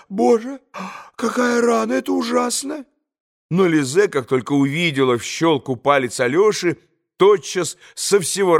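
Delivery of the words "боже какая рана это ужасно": high shocked cry at the wound; cry of alarm. A narrator's reading. Mother seeing Alyosha's bloody finger, high alarmed cry. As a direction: gasp it out, horrified. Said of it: "вскрикнула".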